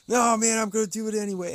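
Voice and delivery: hammed-up "gonna do it anyway"; imitating stubborn donor. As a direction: donor refusing to take no for an answer. Donor insists, voiced.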